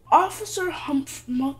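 usual speaking voice

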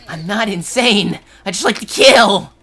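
loud, high madman's protest; mock-manic shout. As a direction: belt it out like a pretend maniac.

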